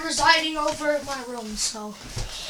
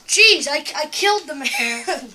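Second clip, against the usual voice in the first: normal talking voice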